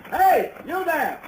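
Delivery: slow, drawn-out hail to a passing wagon; calling out. Acted. Stranger hails the wagon from the road, calling out. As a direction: call out to the wagon from the roadside.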